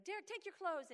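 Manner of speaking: busy mom's bossy call; barking orders. Retold or imitated. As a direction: snap out chores at the kids. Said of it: "You're barking out orders all day".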